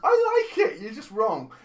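high, loud indignant protest; indignant outburst. Real, not acted. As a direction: fire back, mock offended. Defending sitcom against insult.

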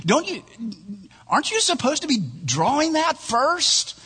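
high-pitched, hesitant worried client; alarmed question. Acted. Alarmed client stops artist in ad.

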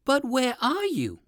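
drawn-out, puzzled wondering. Acted. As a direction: ask as a baffled old rabbit. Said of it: "the puzzled old gentleman rabbit".

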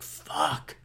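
soft, drawn-out waking curse; groggy curse. Acted. Host acting out himself hearing the alarm, quiet groggy curse. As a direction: swear under your breath on waking. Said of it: "I used to do that myself".

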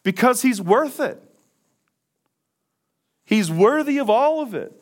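high, loud, impassioned preaching; soaring preacher's cry; fervent preaching. Acted. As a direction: proclaim it with fire from the pulpit. Preacher at full force, opening.

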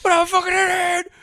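very high, loud mock shriek; hammed-up hysterical outburst; imitated meltdown scream. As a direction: yell it as an unhinged actor. Imitating Cage's overdubbed meltdown line.

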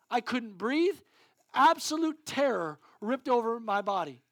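loud, high fervent sermon voice; full-force preaching. Acted. Preacher telling the congregation about panic, fervent. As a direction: preach the fear with urgency.

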